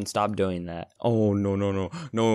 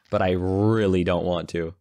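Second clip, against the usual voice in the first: ordinary delivery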